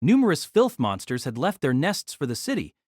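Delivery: normal talking voice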